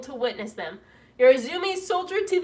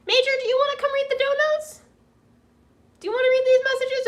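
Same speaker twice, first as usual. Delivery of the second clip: high, loud, quick pet-talk; calling the dog; 10 semitones higher, 7 dB louder, faster than usual. Real, not acted. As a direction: call over to your dog in a high voice. Calls playfully to the dog.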